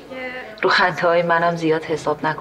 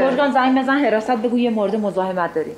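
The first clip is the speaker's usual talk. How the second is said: raised, higher commanding voice; sharp order; 3 semitones higher than usual. Acted. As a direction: order security called right now. Angry order to call security.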